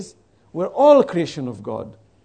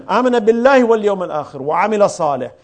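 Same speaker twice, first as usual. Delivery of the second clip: quick chanted Arabic recitation; quranic recitation; 5 dB louder, much faster than usual. Acted. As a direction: recite the verse rapidly in Arabic. Quoting Quran verse in Arabic.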